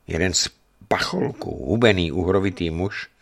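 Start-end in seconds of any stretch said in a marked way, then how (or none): none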